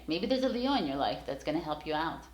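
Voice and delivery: ordinary delivery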